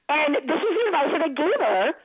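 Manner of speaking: normal talking voice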